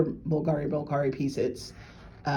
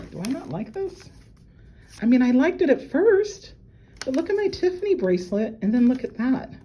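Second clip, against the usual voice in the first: ordinary talk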